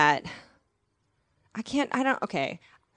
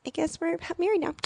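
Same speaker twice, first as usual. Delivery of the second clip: mock dazed couple; 8 semitones higher, 4 dB quieter than usual. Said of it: "two people being like".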